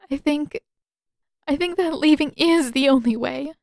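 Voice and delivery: pleading through tears; tearful plea. A narrator's reading. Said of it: "Tears streaming".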